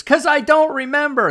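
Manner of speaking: high, loud mock crying; retold tearful outburst. Woman who can't recall her birthplace, imitated tearful cry. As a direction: imitate a distraught wail. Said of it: "she bursts into tears".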